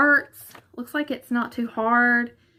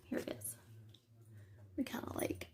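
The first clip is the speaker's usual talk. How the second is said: whisper; 12 semitones lower, 21 dB quieter, faster than usual. Real, not acted. Hiding the block, won't give away.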